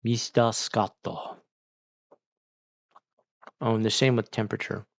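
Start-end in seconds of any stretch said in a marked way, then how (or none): 0.0-1.4 a hint of a villain greeting the hero; booming echoing villain monologue. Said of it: "sounds like a movie villain addressing the hero".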